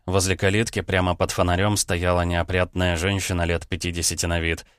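usual speaking voice

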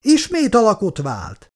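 rising alarm in the voice; alarmed cry. Acted. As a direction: keep shouting the warning. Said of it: "kiáltott fel Wieland".

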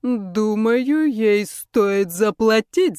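slow, loud cruel ruler; menacing villain. Acted. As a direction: threaten with slow venom. Ruler condemns Rebecca.

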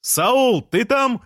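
very high, carrying shout; calling out. A narrator's reading. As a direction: holler up to someone in a window. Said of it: "крикнул Жан".

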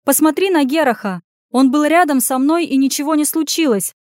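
high, loud, indignant defence; heated arguing. Acted. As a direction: argue back forcefully. Anya argues angrily with lady.

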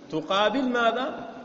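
usual speaking voice

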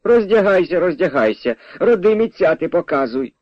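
high-starting, taunting command; mocking. A narrator's reading. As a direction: goad him mockingly to prove it. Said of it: "знущалася Наталка Розумиха".

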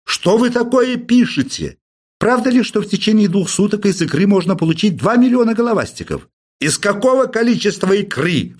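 high, exasperated professor yelling; angry shout. Acted. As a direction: bark the questions in outrage. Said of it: "взбеленяясь закричал Персиков".

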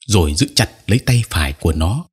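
ordinary delivery, nothing marked

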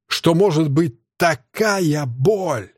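такая stretched high and very slow; anguished, drawn-out такая; agonised emphasis. A narrator's reading. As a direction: drag out такая боль in pain. Narrator reliving torture, repeats in agony.

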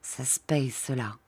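low murmur, trailing very soft. A narrator's reading. Rachel murmurs a threat to the officer, softly. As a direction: murmur it quietly to him.

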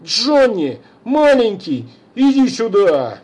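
high, loud coaxing plea; coaxing call. Acted. Father calls his son over, coaxing shout. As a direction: coax the boy to come back.

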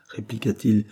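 ordinary delivery

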